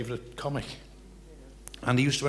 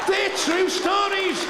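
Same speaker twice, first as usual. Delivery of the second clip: slow, high, loud laughing protest; laughing insistence; 6 dB louder, much slower than usual. Real, not acted. Laughing insistence after gag.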